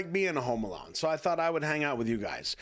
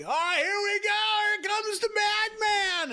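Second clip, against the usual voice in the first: very high, drawn-out mock announcer yell; hammed-up falsetto holler; mock hype shout; slower than usual. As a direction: imitate a crowd hollering, way up high and slow. Mimicking people hyping his arrival.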